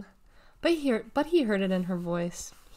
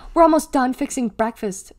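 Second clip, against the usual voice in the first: sharp, louder scolding, lightly acted; mild hiss; 4 semitones higher, 7 dB louder than usual. A narrator's reading. Sister scolds Deli over breakfast, mildly hissed. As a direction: scold a sibling with a touch of venom. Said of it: "she hissed".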